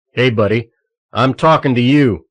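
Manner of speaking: loud, insistent guard voice; gruff call. A narrator's reading. Guard calling Targeno out again, loud and gruff. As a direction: bark it, demanding attention.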